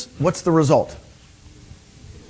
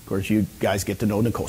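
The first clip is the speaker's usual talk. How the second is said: ordinary delivery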